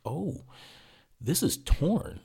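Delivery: drawn-out, high mock realization; imitating physician's assistant. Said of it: "and she goes".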